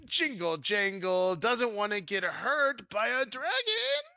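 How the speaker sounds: high, slow goblin whine; squeaky reluctant goblin; Jingle Jangle, fearful. Acted. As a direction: draw it out loudly as a scared little goblin. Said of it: "And he says".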